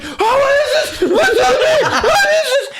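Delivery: very high, squealing mock yell; panicked yelling. Guard with a mouse in his pants, high panicked yelling. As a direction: yelp it out in comic panic. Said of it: "He just starts yelling".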